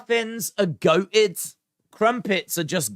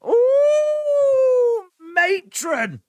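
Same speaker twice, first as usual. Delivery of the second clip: much higher, louder camp squeal; camp ooh matron; 7 dB louder, much slower than usual. Pretend. Reacting to toad in the hole, camp much higher squeal. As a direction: shriek it like a cheeky seaside comedy. Carry On style innuendo exclamation.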